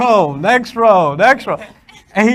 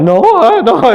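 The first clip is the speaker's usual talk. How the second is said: put-on kid denying; 5 semitones higher, 4 dB louder, slower than usual.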